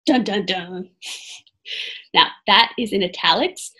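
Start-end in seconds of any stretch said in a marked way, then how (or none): 0.0-0.9 low "dun, dun, dun" drumroll; mock suspense sting. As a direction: intone a dramatic cliffhanger sting. Imitates suspense sting after warning.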